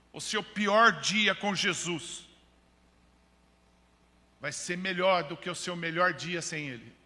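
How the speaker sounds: proclamar com força e pausas; pregação enfática. Real, not acted. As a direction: declarar uma promessa à igreja, palavra por palavra. (pregação forte e solene)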